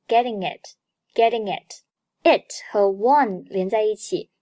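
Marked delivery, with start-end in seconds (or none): 0.1-1.8 "getting it" run together as one word; linked pronunciation. Shown as a demonstration. Teacher demonstrates 连读, "getting it" linked. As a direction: link the end of "getting" into "it". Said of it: "Getting和it连读".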